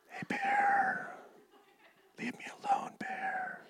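whispered plea to the bear. Shown as a demonstration. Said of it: "talking in a soft tone".

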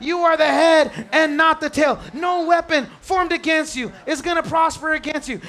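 put-on loud preaching shout over the child; fervent declaration. Preacher voicing parent's declaration.

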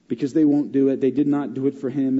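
usual speaking voice

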